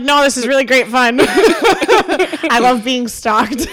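hammed-up cheerful irony, laughing; laughing sarcasm. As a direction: drip sarcasm through the laughter.

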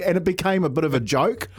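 usual speaking voice

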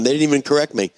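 ordinary talk

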